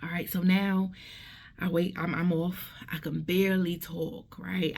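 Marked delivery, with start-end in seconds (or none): none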